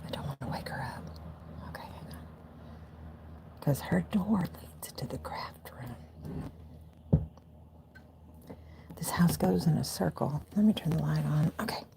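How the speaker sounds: hushed whisper at night. Real, not acted. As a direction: keep it to a breathy whisper. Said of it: "I'm gonna whisper for a second".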